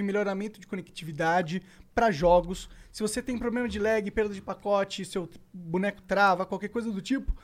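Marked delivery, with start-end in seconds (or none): none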